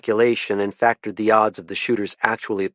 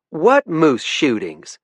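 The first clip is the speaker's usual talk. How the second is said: slow, higher twangy Southern question; twangy Southern accent; 7 semitones higher, 4 dB louder, much slower than usual. Acted. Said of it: "in a twangy accent".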